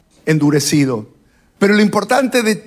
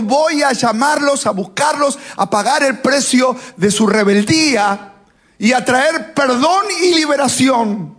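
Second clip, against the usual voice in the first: proclaiming Jesus; 6 semitones higher than usual. Acted. Preacher voices Jesus's proclamation.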